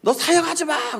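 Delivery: high scolding, building to a loud order; imitated angry outburst; scolding pastor. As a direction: dismiss a junior in acted anger. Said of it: "막 뭐라 하시다가".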